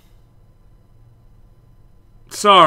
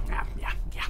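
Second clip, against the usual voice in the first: whispered; 12 dB quieter than usual. Real, not acted. Muttering agreement to game dialogue.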